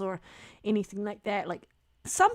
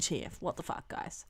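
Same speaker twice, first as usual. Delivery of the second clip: muttered; 4 semitones lower, 12 dB quieter than usual. Real, not acted. Muttered exasperation.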